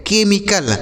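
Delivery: "केमिकल" with k for the CH; model pronunciation. Shown as a demonstration. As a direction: say "केमिकल" on its own as KE-mi-kal. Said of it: "इसे पढ़ेंगे".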